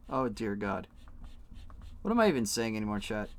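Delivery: ordinary talk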